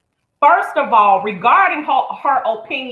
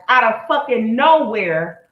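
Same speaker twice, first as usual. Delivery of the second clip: usual speaking voice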